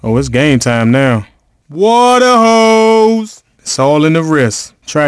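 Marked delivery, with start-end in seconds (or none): 1.7-3.4 long, stretched-out hype call; drawn-out cheer. Acted. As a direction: stretch out the shout slowly. Trash talk after a made shot.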